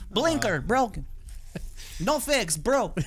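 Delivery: high alien driver voice; road rage. Acted. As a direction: scold like a tiny alien. Mocking a driver in a voice.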